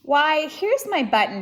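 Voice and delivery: thrilled to find his lost button; cried out. Acted. Corduroy finding his button, excited cry. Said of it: "he cried".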